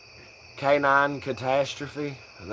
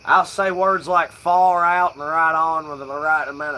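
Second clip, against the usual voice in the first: southern hippie twang; 5 semitones higher, 6 dB louder than usual. Shown as a demonstration. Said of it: "southern hippie redneck slang sound".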